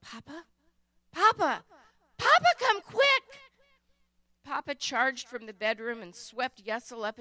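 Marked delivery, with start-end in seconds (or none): 0.0-0.4 a hushed, trembling whisper of Papa; frightened whisper. A narrator's reading. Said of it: "It started as a whisper". 1.1-3.2 high, loud squeal calling for Papa; terrified squeal. A narrator's reading. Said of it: "ended as a squeal".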